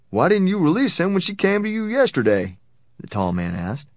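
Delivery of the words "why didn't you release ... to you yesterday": high, pressing, indignant question; confronting. A narrator's reading. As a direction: challenge them with pitch right up. Tall man confronts the warden.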